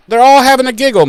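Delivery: high, fast, bouncy mocking of the gang's giggle; mock giggly. Mocking friends giggling through a serious episode, high and fast. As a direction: chirp it up high and quick.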